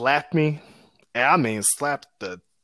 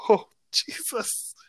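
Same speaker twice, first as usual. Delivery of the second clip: slow, breathless whisper of dread; hushed, slow "Oh, Jesus"; 9 semitones higher, 3 dB quieter, slower than usual. Retold or imitated. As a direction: whisper it like doom is coming. Dreading being grounded.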